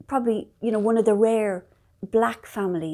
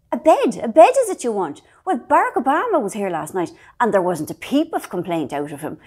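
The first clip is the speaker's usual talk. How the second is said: loud, scandalised Irish landlady booming back; a hostess outraged that a guest would complain; indignant landlady; 8 dB louder than usual. Retold or imitated. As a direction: bellow it like an affronted landlady defending her room. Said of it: "they go".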